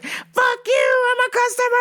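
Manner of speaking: high screeched insult, imitated; mock yelling. Customer cursing the store boss, mock yelling. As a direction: screech it back in hammed-up outrage. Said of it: "She was yelling".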